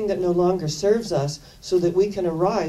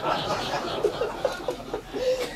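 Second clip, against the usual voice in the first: breathless laugh; 5 dB quieter than usual. Real, not acted. Laughs at her own story.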